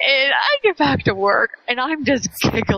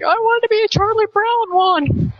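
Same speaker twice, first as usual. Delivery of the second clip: put-on high, eager little-boy voice, higher and louder; imitating a kid; 11 semitones higher, 4 dB louder than usual. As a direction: mimic a child's excited request. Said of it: "He's like".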